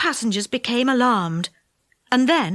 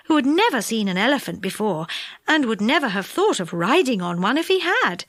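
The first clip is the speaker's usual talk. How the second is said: plain reading voice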